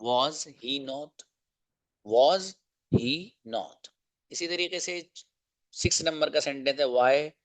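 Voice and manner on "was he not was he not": each word held apart slowly; slow drill of the long form; slow, separated. Shown as a demonstration. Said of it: "आप अलग लिख सकते हो".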